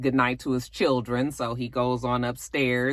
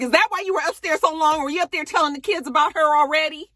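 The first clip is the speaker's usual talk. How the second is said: high, fast, loud suspicion; suspicious; 13 semitones higher, 3 dB louder, faster than usual. Retold or imitated. Ex-wife grilling him, high and fast. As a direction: fire the questions high and quick. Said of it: "she was just like".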